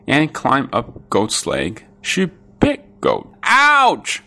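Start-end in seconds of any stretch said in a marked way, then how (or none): 3.4-4.2 high, loud yelp of pain; cried ouch. A narrator's reading. Goat bitten by the ant, high loud yelp. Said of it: "cried goat".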